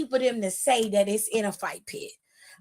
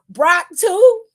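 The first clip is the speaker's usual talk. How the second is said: loud, high disbelieving outcry of Brock too; incredulous shout; 7 semitones higher, 10 dB louder than usual. Real, not acted. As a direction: yell Brock too like you can't believe it. Incredulous exclamation.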